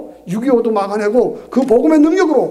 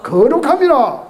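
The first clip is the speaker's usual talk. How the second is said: high, loud prompt to the congregation; slow, emphatic shout to a congregation; loud call-out; 5 semitones higher, 6 dB louder, slower than usual. Real, not acted. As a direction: call it out big, drawn out. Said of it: "다시 크게 뭐라고요?".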